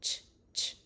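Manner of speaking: whispered "ch, ch"; ch sound. Shown as a demonstration. Therapist starting ch practice. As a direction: model ch for practice. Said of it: "we are going to practice ch".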